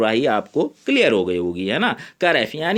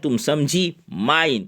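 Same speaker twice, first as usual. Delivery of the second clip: usual speaking voice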